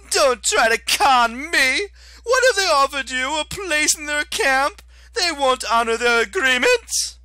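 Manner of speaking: whiny high-pitched fury, dragged out; Octavian, shrill and outraged. Acted. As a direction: screech like a wounded petty tyrant. Octavian character voice.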